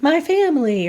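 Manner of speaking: high, singled-out stock answer; mock obvious answer. Woman giving an example of a too-easy gratitude, mock obvious answer. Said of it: "they can't be like".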